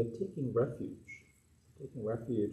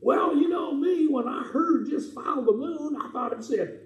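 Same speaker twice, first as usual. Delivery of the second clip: high excited puppy voice, much higher and louder; rascal puppet dog; 11 semitones higher, 5 dB louder, slower than usual. Acted. Puppet dog Barkley tells the children his mix-up in a high, excited voice. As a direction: play the goofy rascal dog.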